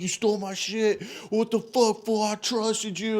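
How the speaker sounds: high-pitched imitation of a hurt outburst; emotional accusation. Storyteller confronting friends over stolen cards, mock emotional accusation. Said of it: "I'm all emotional, all fucked up".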